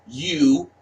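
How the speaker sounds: normal talking voice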